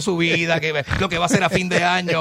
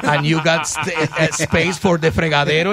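put-on very slow, careful Spanish-accented English; showing a tenant the apartment in broken Spanish-accented English; slower than usual. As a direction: spell it out slowly in Spanglish for a foreigner. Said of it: "le digo yo".

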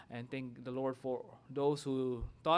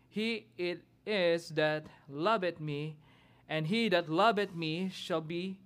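scripture reading; 4 semitones higher, 3 dB louder than usual. Acted. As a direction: proclaim the verses from the pulpit. Reads scripture aloud, responsive reading.

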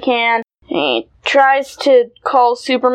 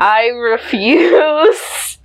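dramatic slow refusal; much slower than usual. Acted. Mock refusal in bit.

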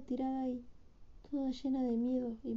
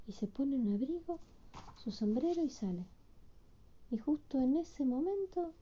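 usual speaking voice

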